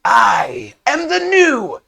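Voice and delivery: high, drawn-out kobold proclamation; boastful kobold. Acted. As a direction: crow it like a creature's new leader. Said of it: "the kobold with the spear out says".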